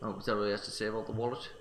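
ordinary talk, nothing marked

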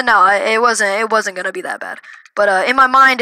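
ordinary delivery, nothing marked

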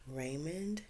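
ordinary delivery

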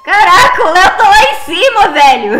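high, loud laughing outburst; laughing exclaim. Real, not acted. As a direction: burst out laughing in surprise. Bursts out at Léo's position.

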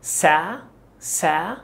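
the syllable "سا" said alone, slowly. Shown as a demonstration. Teacher drilling the first syllable, slow and separate. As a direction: break "سا" off as its own beat. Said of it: "الكلمة دي تلات مقاطع".

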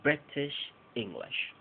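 "British English" slow with short i, no schwa; slow careful exception. Shown as a demonstration. Teacher demonstrating the exception slowly for learners. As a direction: draw out each short i slowly. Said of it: "No hay que convertirlo en schwa".

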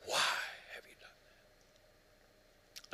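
whispered, broken why; hushed whisper. Acted. Preacher ending Jesus' cry, a soft stunned whisper. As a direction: let the scream collapse into a whisper. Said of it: "he cried and screamed".

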